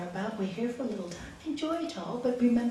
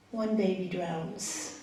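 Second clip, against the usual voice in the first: normal talking voice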